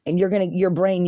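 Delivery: usual speaking voice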